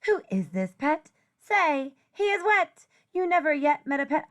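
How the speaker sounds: plain reading voice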